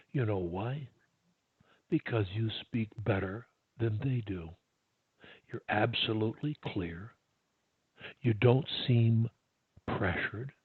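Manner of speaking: low, soft, unhurried phrases with pauses; calm and measured. Shown as a demonstration. Coach reassuring client they speak better, calm and soft.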